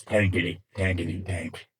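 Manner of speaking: low-pitched, deadpan chant; man playing tank. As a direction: trundle the words out low, like a tank. Punchline, unstoppable man imitating a tank.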